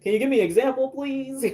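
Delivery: high-pitched exasperated request to a customer; pleading retold reply. Acts out her reply to vague customers.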